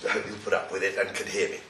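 hoarse, croaky from a cold. Real, not acted. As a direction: talk through a croaky throat. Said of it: "sorry about my croaky voice".

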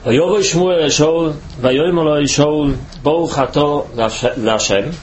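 usual speaking voice

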